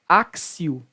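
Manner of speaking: axio with an S sound, "áksio"; correct Latin pronunciation. Shown as a demonstration. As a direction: axio as "AK-sio". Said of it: "o T aqui tem som de C".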